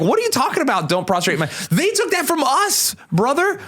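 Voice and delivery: high-pitched indignant comeback; worked-up mock outrage; exasperated retort. Acted retort to critical Catholics.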